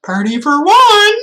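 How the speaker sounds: mock the lonely party; sarcastic quip. Real, not acted. Streamer joking about the lonely party host, sarcastic quip.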